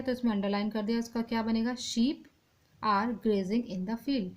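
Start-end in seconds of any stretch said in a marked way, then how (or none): 1.7-4.3 slow read-out. Shown as a demonstration. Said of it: "तो इसमें शीप गलत है".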